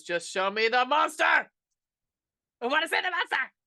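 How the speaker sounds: high mock-tantrum yell, building; impatient demand. Fed-up viewer demands to see the monster, impatient mock yell.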